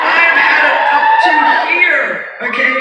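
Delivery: loud, high mock yell at the director; retold shout. Snapping at the director who called her too old, loud mock yell. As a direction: blow up at him down the phone. Yelling back at insulting director.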